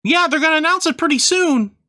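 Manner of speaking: high squeaky Christmas elf; chirpy elf shopkeeper, way up high. Acted. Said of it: "I do an elf voice".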